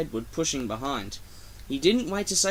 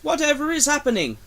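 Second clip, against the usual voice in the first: high panicked trucks voice; shrieking trucks; 12 semitones higher, 5 dB louder, faster than usual. A narrator's reading. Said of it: "screamed the trucks".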